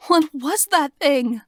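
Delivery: high, scared question; high-pitched. Acted. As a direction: ask in a high voice. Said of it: "I ask in a high-pitched voice".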